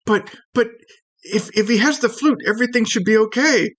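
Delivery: high little stammering protest; tiny creature arguing. Acted. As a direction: argue back in a small, high voice.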